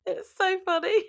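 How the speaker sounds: high, loud, laugh-squeezed voice; cracking up. Real, not acted. Laughing too hard to redo it, high squeal. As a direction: squeal it through real laughter.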